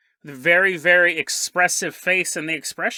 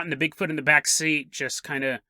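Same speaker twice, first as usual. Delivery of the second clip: normal talking voice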